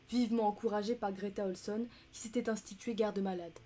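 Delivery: ordinary delivery, nothing marked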